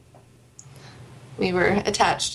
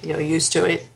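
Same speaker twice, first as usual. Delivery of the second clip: lower-pitched try at an Australian accent; attempted Australian accent; 4 semitones lower than usual. Shown as a demonstration. Co-host echoing the Australian to test herself, tentative Australian accent. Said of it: "Is that close?".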